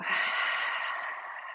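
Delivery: long open-mouth sigh; cleansing exhale. Shown as a demonstration. Teacher showing how to release stale air, a long sigh. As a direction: let all the air out in one long, voiceless breath. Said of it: "let it go".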